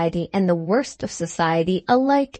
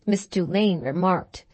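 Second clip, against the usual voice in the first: ordinary delivery, nothing marked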